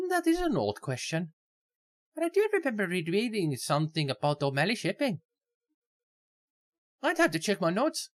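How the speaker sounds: put-on Irish accent. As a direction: give her a high Irish lilt. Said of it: "Irish accent".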